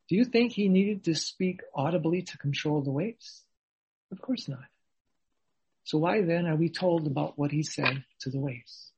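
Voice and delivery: ordinary talk, nothing marked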